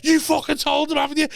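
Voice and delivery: high-pitched mock accusation; high, heated retold outburst; retold scream. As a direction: imitate an irate dad accusing mum. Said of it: "he screamed at me ma".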